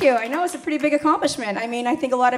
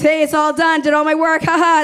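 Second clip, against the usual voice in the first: smug bride; 3 semitones higher, 6 dB louder than usual. Pretend. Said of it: "She thinks".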